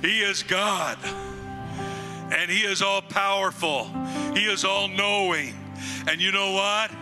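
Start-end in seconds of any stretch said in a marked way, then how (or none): none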